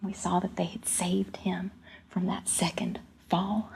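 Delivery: very soft, near-whispered narration; quiet awe; hushed storytelling. A narrator's reading. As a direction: reveal the rescue under your breath. Hushed relief after second fall.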